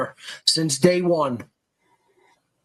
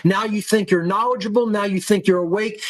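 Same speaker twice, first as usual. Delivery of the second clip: ordinary talk, nothing marked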